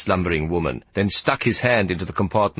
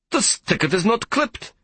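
very high accusing voice with stress on not; 13 semitones higher than usual. Acted. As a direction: charge the boy with the offence. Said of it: "he said accusingly".

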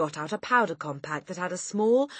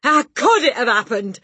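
high, loud outburst; bewildered yell; 7 semitones higher, 11 dB louder than usual. A narrator's reading. Father questioning family, baffled yell. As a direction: yell it at the whole room. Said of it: "he yelled".

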